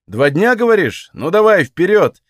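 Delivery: loud, high-pitched challenge; defiant dare. A narrator's reading. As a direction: dare them to go ahead. Daring her in a quarrel.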